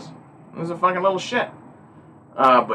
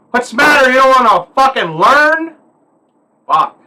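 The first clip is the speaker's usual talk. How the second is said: scolding elder; 9 semitones higher, 7 dB louder than usual. Retold or imitated. Old folks scolding a kid who won't learn, loud and high. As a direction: bark it like an old man fed up with a kid. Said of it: "That's what they say".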